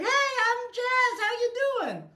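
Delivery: mock an overly sweet phone greeting, stretched out; fake cheery receptionist. Mocking how staff greet clients on calls, high and syrupy. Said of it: "you don't have to be like".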